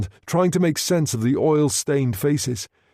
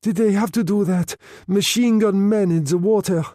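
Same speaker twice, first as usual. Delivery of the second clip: higher, breathless and shocked; breathless, appalled woman's voice; gasping; 8 semitones higher than usual. A narrator's reading. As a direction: gasp out the horror.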